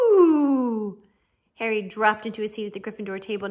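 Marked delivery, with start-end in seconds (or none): 0.1-1.0 loud falling "woo" taunt; drawn-out mocking "ooh". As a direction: hoot a loud, sliding ghostly woo. Taunting cry in read story.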